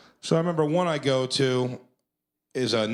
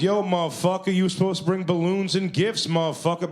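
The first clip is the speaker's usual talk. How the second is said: raised pitch, indignant retold challenge; confrontational host; 7 semitones higher than usual. Party host confronts costume performer over balloons, confrontational. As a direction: press the complaint, pitched up.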